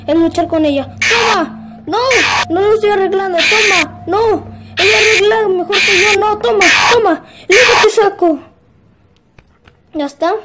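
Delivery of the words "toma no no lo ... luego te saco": loud combative shouts; battle yelling. Real, not acted. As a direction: yell while fighting. Fighting the animatronic. (gritos de pelea)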